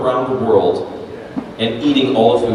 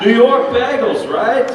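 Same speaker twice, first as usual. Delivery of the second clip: put-on high-pitched New York accent; 9 semitones higher, 3 dB louder than usual. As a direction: pitch it up like a pushy New Yorker. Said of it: "people actually spoke like that".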